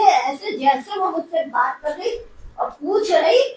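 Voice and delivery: ordinary talk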